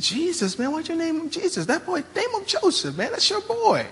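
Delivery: high, soft, disbelieving pal; incredulous buddy. Acted. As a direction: play the friend who can't believe the name. Mocking a friend questioning Joseph.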